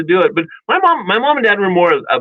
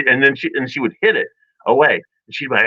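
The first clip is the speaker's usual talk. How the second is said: usual speaking voice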